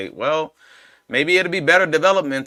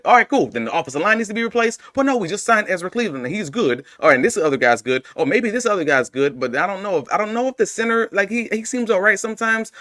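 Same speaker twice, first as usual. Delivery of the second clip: higher-pitched, exasperated arguing with oneself; mock back-and-forth debate; 8 semitones higher than usual. Host acting out fans shifting blame around the team, mock exasperation. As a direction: play out a circular argument, pitch riding high.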